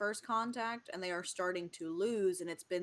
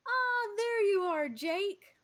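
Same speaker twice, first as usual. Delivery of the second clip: much higher and louder, a delighted hello; cheery greeting; 13 semitones higher, 7 dB louder, slower than usual. Acted. God greeting Jake on Zoom, high and delighted. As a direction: greet him like an overjoyed host. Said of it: "decidedly less booming now".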